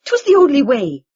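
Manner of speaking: high, loud spluttered excuse; spluttering. Acted. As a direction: splutter it out defensively. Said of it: "Sean spluttered".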